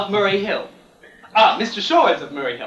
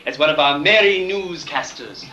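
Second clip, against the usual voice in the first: ordinary delivery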